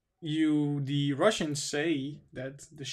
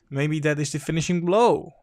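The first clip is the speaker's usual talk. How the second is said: louder, pushed out; emphatic; 8 dB louder than usual. Real, not acted. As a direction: land the line hard and loud. Repeats line, first take pushed.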